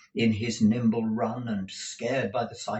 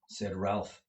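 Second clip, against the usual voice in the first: ordinary delivery, nothing marked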